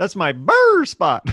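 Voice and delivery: playing the dog.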